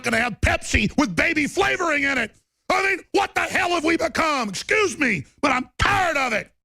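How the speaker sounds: high, furious yelling; real outraged shout, pitch way up. Real, not acted. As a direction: let it explode, fed up. Ranting outburst.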